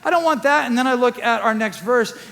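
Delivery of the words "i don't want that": higher and louder, pushing back; whiny refusal, arguing with Jesus; protesting to Jesus. Retold or imitated. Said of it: "I'm like".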